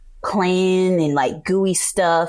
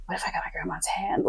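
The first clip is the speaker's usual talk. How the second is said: breathy whispered musing, fast; mock whisper; 10 dB quieter, much faster than usual. Joke writer pictured plotting, fast whisper. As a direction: whisper it like a sneaky idea.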